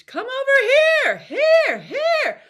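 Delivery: very high, loud call of Come over here, here, here. Shown as a demonstration. Teacher demonstrating a belted call. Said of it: "You can get it where it's".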